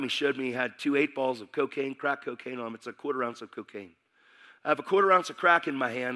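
ordinary delivery

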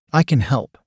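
ordinary delivery, nothing marked

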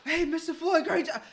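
high, loud congratulating voice; cheering passengers. Retold or imitated. Passengers praising their driver after the scuffle, high and cheery. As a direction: imitate an excited crowd, high and loud.

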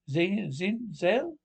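German agent unsure, asking Zell? with a sharp rise; mock German accent. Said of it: "Two German agents".

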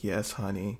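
small, quiet, obedient mock reply; mock meek. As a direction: softly imitate a submissive boyfriend. Meek partner after big talk, imitated.

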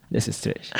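"That's a stretch" breathed, unvoiced; whispered. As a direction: whisper it under your breath. Repeats the phrase in a whisper.

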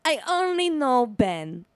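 put-on slow, drawn-out Italian-accented purr; effortlessly sexy. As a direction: imitate a flirt who isn't even trying. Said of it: "in the most, like, sexy, but, like, not even trying hard way".

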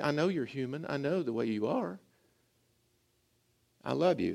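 God speaking softly to his struggling people; tender reassurance. Retold or imitated. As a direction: reassure them quietly, like a loving father. God's voice quoted tenderly.

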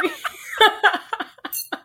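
high breathy burst of laughter; laughing. Real, not acted. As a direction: lose it and crack up. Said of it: "couldn't even freaking say it with a straight face".

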